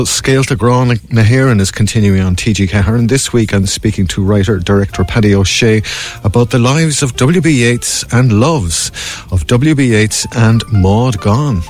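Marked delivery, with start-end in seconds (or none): none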